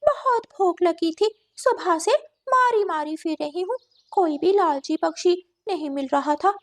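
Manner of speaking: lightly gloating cat voice; scheming cat. Acted. Cat confesses hunger to trapped crow, lightly gloating.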